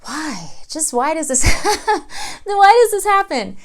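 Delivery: high, exasperated mock plea with a laugh; exasperated why. Loan officer venting at clients' habits, exasperated. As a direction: throw up your hands in disbelief. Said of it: "every single time I'm like".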